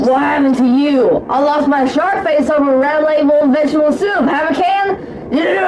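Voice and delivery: loud and shouty throughout; loud shouting. Acted. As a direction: bellow every word. Loud commercial dialogue.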